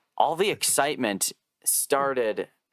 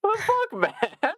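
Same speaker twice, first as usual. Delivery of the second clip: much higher, incredulous imitation; mock outraged disbelief; faster than usual. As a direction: squeak out the outrage like a stunned player. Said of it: "He was like".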